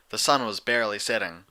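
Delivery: ordinary delivery, nothing marked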